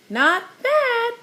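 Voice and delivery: high parrot chatter, repeating the words. A narrator's reading. Parrot Petey echoing Danny, high chattering squawk. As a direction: chatter it back like a pet parrot. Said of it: "chattered Petey".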